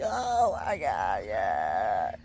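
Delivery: very high, very slow stretched oh my God; imitated surprised gasp. Dad imitating his girlfriend spotting the proposal sign, surprised shout. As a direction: mimic a fiancée spotting the sign at last. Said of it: "and she's like".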